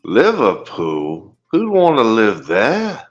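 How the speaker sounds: slow, Liverpool-tinged fake Aussie; botched Aussie accent, sounding Liverpudlian; Australian accent gone Liverpool. Guest scoffs at Liverpool, in a botched Aussie accent. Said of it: "sounds more like fucking Liverpool".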